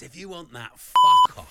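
high, loud screamed swear; mock rant at listeners at Christmas, screamed; mock explosive swear. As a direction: blast the last words out as a joke. Mock rude Christmas message.

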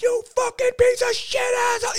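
high mock road-rage yell; imitated road rage scream. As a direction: scream at the other driver.

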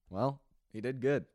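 ordinary talk

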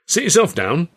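put-on hearty old governor voice; jovial Carno. A narrator's reading. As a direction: boss settling a guest in.

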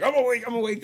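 high, hurried just-woke-up voice; groggy fake-alert. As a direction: pretend you were never asleep. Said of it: "like that".

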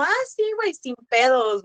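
high, breezy mock voice of a relaxed hookup; casual partner's high, easy "no worries"; easygoing casual partner. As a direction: toss it off like it's no big deal. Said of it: "casual partners reacting".

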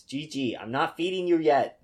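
scolding the dog; firm with the pet; talking to the dog. Real, not acted.

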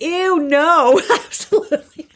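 high, loud laughing ew no; laughing refusal. Retold or imitated.

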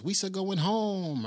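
high, drawn-out cartoon creature voice; Jar Jar. Jar Jar about to fly back to Naboo, high creature voice. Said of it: "Jar Jar goes".

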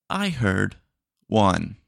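loud, flat machine confirmation; robot voice. Acted. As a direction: mishear like a dumb bot. AI bot mishears again.

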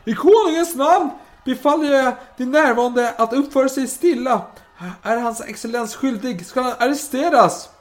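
high, loud official proclamation; acted herald's shout in the king's name; shouted command. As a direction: bellow an order to the crowd. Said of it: "och skriker".

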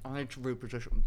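normal talking voice

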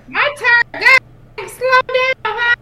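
high, loud scolding; exasperated protest. Real, not acted. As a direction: shout at friends to slow down. Mock scolding the hurried co-players.

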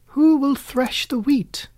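high, hopeful hen voice; hopeful call. A narrator's reading. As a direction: call out hoping someone helps. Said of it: "in a very hopeful tone".

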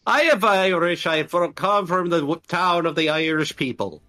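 exaggerated mock Irish; hammed-up Irish accent. Claiming to be from an Irish town, mock Irish. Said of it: "Irish is number four".

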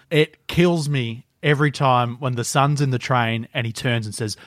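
ordinary talk, nothing marked

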